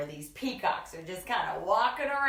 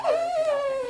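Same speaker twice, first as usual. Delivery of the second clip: excited; 5 dB louder, much faster than usual. Real, not acted. Praising child.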